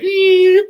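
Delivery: sharp high, loud eep; squeak of fright. Retold or imitated. Flying car, a loud high squeak of fright. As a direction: yelp it like a startled cartoon.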